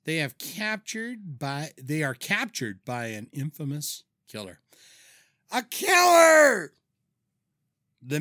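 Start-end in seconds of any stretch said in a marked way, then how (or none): 5.5-6.7 high, slow, loud movie-trailer yell; dramatic shout. Acted. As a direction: belt it like a horror trailer.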